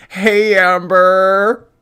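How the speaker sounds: loud, high, long-drawn mock greeting; Alexis. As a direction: mimic the caller, stretching each word out. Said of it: "Doesn't this sound like Alexis?".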